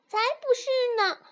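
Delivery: very high, quick cub voice; contradicting little fox. A narrator's reading. Little fox contradicts relieved mother, high quick voice. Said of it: "小狐狸又说道".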